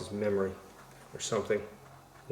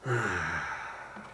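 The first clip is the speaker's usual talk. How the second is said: long falling sigh; 3 semitones lower, 4 dB quieter than usual. Real, not acted. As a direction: let the laughter out in a sigh. Sighs after long laugh.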